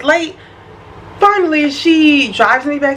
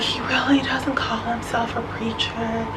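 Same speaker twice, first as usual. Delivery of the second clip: whispered friend imitation; 10 dB quieter than usual. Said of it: "it's like".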